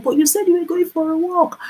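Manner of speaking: very high incredulous husband voice; disbelief. Retold or imitated. Husband learns she lied about the walk, very high disbelief. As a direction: protest in stunned disbelief. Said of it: "He's like".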